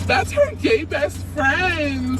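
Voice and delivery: hammed-up gasp, then slow and high; sarcastic fake gasp at the rumor; mock gasp. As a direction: pretend shock, drawn out slowly. Mocking rivals' claim.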